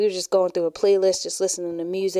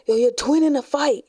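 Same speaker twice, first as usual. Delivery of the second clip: higher, louder mock call-out; retold yell; 6 semitones higher, 5 dB louder than usual. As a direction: imitate kids yelling news up the bus. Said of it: "they yelling up to Shawanda".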